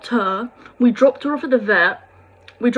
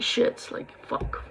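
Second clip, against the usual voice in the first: whispered despair; 10 dB quieter, slower than usual. Retold or imitated. Breaking down at dog's bed.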